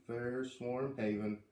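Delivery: ordinary delivery, nothing marked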